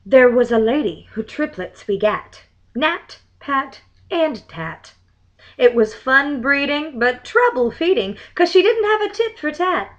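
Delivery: lively verse recital; limerick reading. Acted. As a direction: recite it like a party rhyme. Reads limerick aloud.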